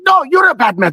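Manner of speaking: a squeaky indignant comeback, lightly acted. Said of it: "I'm like".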